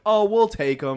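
high, bright-sounding mock offer; a hint of a smug school official; lightly voiced other school; mock generous offer. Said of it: "they're like".

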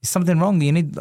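a hint of a fretting father checking on his kid; a worried dad. Dad asks his young son, a touch of worried father.

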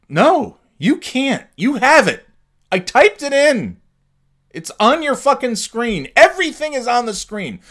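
very high, loud exasperated shouting; yelling at the rep who asks again; furious phone yell. Retold or imitated.

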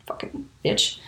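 whispered curse under the breath; a sharp whispered jab; whispered aside. Real, not acted. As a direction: hiss the insult to yourself. Insult muttered aside.

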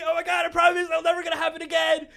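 very high, loud, frantic promising; gushing relief. Retold or imitated. Driver let off by the officer, hysterically relieved promising. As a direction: swear it breathlessly in an overjoyed squeal.

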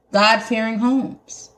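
ordinary talk

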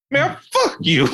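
high, cheeky cuss-out; bratty kid. Retold or imitated. Kid cursing at the driver, high cheeky retort. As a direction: spit it back like a smug nine-year-old.

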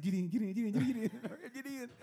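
high, rattled-off repeated command; urgent hurrying. Retold or imitated. Host rushing friend through the door, high urgent hurrying. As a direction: hustle someone inside, breaking into laughter.

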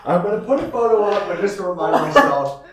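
high, loud giggly voice; laughing excitedly. Real, not acted. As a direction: talk through giggles. Laughing excitedly while showing a photo.

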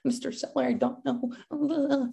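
high, soft, very slow mock timid voice; scared off. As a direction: shrink back like a nervous rookie. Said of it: "all kind of scared off".

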